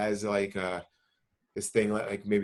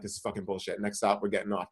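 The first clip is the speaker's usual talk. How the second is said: muttered complaint; 5 semitones lower, 6 dB quieter than usual. Retold or imitated. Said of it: "And you're like".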